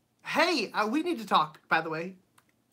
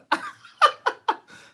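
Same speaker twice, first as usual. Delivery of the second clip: high laugh; 10 dB louder than usual. Real, not acted. Laughing at own foot cramp.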